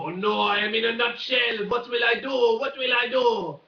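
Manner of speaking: put-on a Frenchman fretting inside a nutshell, French accent; mock French accent. Frenchman stuck in a nutshell, panicky mock French accent. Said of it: "my French accent probably needs a lot of work".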